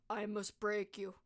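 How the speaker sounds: slow, stoic mock-Russian threat; restrained, emotionless villain imitation; very serious, stoic. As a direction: deliver it deadpan in a slow Russian accent. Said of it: "Very serious".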